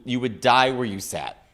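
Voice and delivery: normal talking voice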